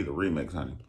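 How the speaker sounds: normal talking voice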